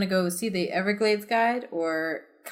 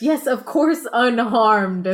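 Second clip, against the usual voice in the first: woman voice; 4 semitones higher, 6 dB louder, much slower than usual. Acted. Owner voiced.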